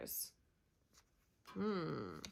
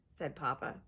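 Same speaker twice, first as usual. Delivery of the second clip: plain reading voice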